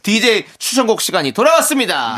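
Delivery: high, loud hyped corner intro; excited announcer. Acted. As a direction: pump up the segment like a host. (신나게 외치는 코너 소개)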